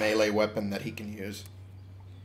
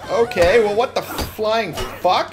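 loud disbelief; 10 semitones higher, 7 dB louder, slower than usual. Real, not acted. Under heavy attack.

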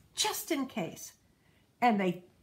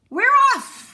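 much higher, much louder cheer; shouted; 13 dB louder than usual. A narrator's reading. Crew and boy cheering as they set sail, loud shout. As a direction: yell it out with the whole crew. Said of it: "we all shouted".

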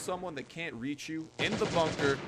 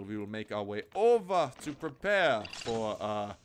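ordinary talk, nothing marked

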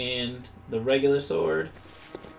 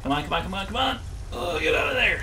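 being chased; 7 semitones higher, 4 dB louder, faster than usual. Real, not acted. Frantic escape from enemy in game.

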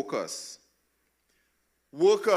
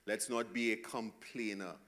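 soft, much quieter gentle warning; loving warning; 4 semitones lower, 8 dB quieter than usual. Real, not acted. Pastor gently warning the church against grumbling, softly. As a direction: lower your voice and warn them lovingly. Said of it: "lovingly warn us".

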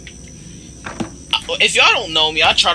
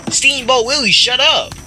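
indignant yelling; 12 semitones higher than usual. Real, not acted. Yelling at character.

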